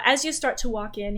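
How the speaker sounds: ordinary delivery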